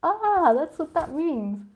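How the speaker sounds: high, loud "ah" then slow; mock realisation. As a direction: act out a lightbulb moment. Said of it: "begin to be opened up".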